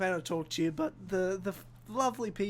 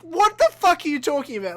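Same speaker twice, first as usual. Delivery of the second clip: louder, higher disbelieving shout; incredulous yell; 8 semitones higher, 10 dB louder than usual. Retold or imitated. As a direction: blurt it in disbelief. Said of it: "I'm like".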